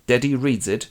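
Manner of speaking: normal talking voice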